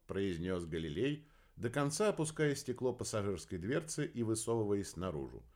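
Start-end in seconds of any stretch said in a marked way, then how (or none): none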